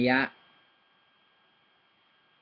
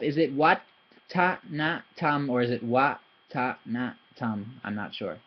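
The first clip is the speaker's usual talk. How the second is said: ordinary delivery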